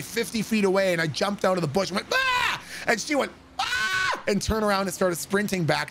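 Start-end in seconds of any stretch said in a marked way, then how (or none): 2.1-2.6 high-pitched pouncing "ah!"; sudden yell. Retold or imitated. Jumps out of bush yelling. 3.6-4.1 high mock shriek of fright; startled scream. Startled girl screams back.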